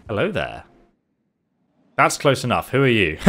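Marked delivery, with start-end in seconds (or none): none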